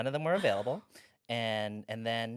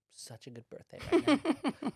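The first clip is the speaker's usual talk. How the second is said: breaking inside; 12 semitones higher than usual. Retold or imitated. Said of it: "breaking on the inside".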